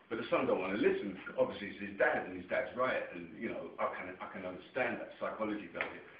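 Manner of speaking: ordinary delivery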